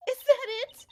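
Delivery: high, loud indignant repeat; indignant outburst. Acted. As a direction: throw the question back, outraged. Witness repeats, much louder.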